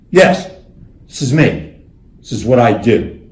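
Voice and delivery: chest-beating pride; excited. Retold or imitated. Presidents proudly claiming their top cards, loud excited boasting. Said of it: "they got all excited".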